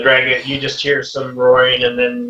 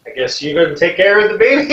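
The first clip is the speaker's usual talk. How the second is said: uneasy question; 7 semitones higher, 4 dB louder, faster than usual. Acted. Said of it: "He's like".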